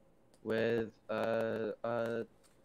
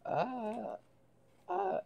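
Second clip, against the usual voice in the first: dazed stammer; 13 semitones higher than usual. Acted. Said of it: "spaces out and goes".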